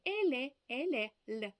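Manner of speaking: «еле» said twice, high and loud, then a lone «л»; sound demonstration. Shown as a demonstration. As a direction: model «еле» with its Spanish l, unlike the Ukrainian one. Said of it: "вчилися вимовляти". (чіткий показ іспанського звука «еле», «л», відмінного від українського)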